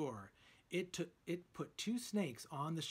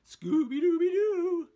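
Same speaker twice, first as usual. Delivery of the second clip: much higher and far louder joyful dog howl; Scooby-Doo cry; 13 semitones higher, 15 dB louder than usual. A narrator's reading. Scooby celebrates the snack treat, joyful Scooby howl. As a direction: howl the catchphrase with glee. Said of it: "cried Scooby".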